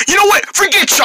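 high, indignant parting shot; angry dismissal. Acted. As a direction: cut the friend off and storm away. Storms off from the quarrel.

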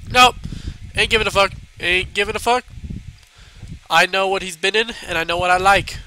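ordinary talk

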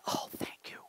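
hushed, whispered retold gratitude; acted-out whisper of relief; whispered thanks. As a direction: barely voice the thanks.

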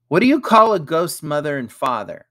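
put-on high, loud child's riddle voice; boy asking riddle. A narrator's reading. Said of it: "asked Ethan".

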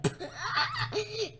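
laugh. Real, not acted. Laughing at teammate.

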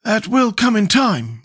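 high, slow character voice; Scarecrow's voice. A narrator's reading. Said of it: "remarked the Scarecrow".

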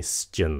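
"shin" as hissed s plus chin; "s-chin" sound. Shown as a demonstration. Teacher has students listen closely, "shin" as s-chin. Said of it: "âm xì".

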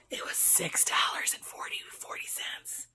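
whispered price aside, under her breath; whispering. Real, not acted. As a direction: breathe it out as a secret.

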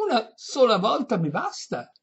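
high, loud, pleased-to-answer voice; deaf old lady. A narrator's reading. Deaf lady thinking she was asked, high loud voice.

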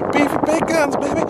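big hyped-up name drop; hype host voice. Acted. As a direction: announce yourself like a hype man. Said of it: "It's your man".

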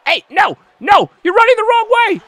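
high, loud yelling at the screen; exasperated gamer yelling; frustrated shout. Real, not acted. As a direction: scream at the teammate who ran away. Partner runs off during match.

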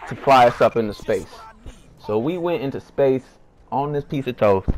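normal talking voice

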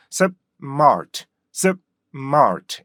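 clipped 습, gap, then mart with r; split in two. Shown as a demonstration. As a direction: cut 습 shut, then punch mart. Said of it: "이렇게 하면". (습 끊고 mart)

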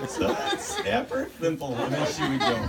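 giggling through the words; laughing. Real, not acted. Telling the family how he snapped her thimble, laughing.

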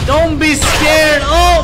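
loud, slow, startled yell at the screen; jolted into shouting. Real, not acted. Player startled by a jump scare, shouting.

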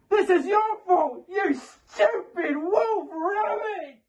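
high, drawn-out screamed accusation; screaming. Acted. Caller blames the departing helper, screaming at full pitch. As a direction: scream the blame, stretching every word. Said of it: "Still screaming".

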